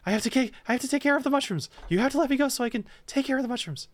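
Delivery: high, jittery fae voice; squeaky, wound-up pixie; frantic tiny creature. Acted. Quickling voice.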